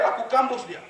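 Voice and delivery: ordinary delivery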